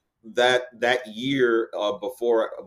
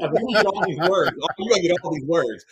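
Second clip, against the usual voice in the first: higher, lively mock voice of his younger self; playful self-mockery, pitched up; mock eager self; 8 semitones higher than usual. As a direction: tease himself with a hammed-up eager tone. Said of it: "Right, like".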